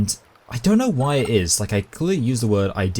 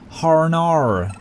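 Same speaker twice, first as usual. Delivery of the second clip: high, drawn-out "Harnar" as Australian "oh no"; Australian-sounding "oh no"; 8 semitones higher, 3 dB louder than usual. Shown as a demonstration. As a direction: stretch "Harnar" into an Australian wail. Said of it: "sounds exactly like the way that Australians say".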